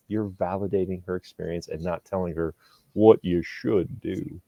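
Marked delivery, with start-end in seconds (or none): 3.0-4.4 very slow cowboy drawl; John Wayne. Acted. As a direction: stretch it out like a mock movie cowboy. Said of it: "don't ever use that voice".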